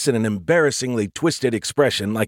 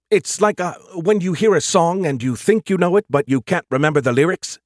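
higher-pitched AI groping for words; puzzled AI, pitched up; puzzled AI character; 7 semitones higher, 4 dB louder than usual. Acted. Skippy explaining a half-remembered feeling to Joe, puzzled.

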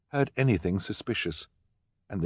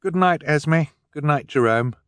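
put-on higher polite girl voice; young girl Violet; 9 semitones higher, 5 dB louder than usual. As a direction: sweetly say goodnight. Violet says goodnight.

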